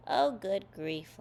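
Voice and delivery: usual speaking voice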